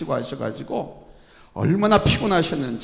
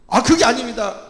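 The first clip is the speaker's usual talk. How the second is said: higher, louder protest; raised voice; 9 semitones higher, 9 dB louder than usual. Retold or imitated. A church member objects in a raised voice. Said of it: "목소리를 높여서".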